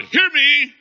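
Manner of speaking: high, loud demand for attention; urgent shout. Real, not acted. Preacher demands the congregation listen, shouting urgently.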